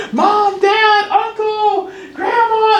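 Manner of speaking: put-on very high, loud child calling out; excited child. Child running up as you get home from work, excited high calls. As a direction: squeal the names like a kid running up. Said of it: "your child comes up to you".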